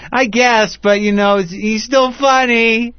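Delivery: mock the indifferent fan who just doesn't care; shrugging fan. Host mocking Dane Cook fans, high shrugging voice. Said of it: "they're like".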